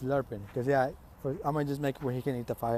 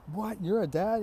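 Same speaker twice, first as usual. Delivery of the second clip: higher, louder incredulous question; acted blurt of disbelief; mock shock; 7 semitones higher, 4 dB louder than usual. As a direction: imitate a stunned classmate. Others react to dad news.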